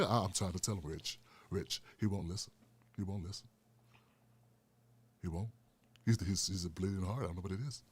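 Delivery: low, soft complaining elder; Paul Mooney impression. Paul Mooney's ghost complaining to Richard, low. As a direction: mutter it low and weary. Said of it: "Rich and I were talking".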